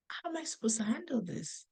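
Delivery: unvoiced inner whisper; whispered thought. Mother voicing her anxious thought, whispered. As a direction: breathe it out as a private worry. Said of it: "And I'm thinking".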